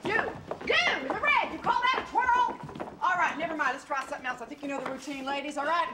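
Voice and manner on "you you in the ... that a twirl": single her out with scorn; scornful call-out. Acted. Scolding a contestant.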